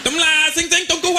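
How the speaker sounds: high, shouted mock Chinese gibberish. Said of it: "I talked to my wife".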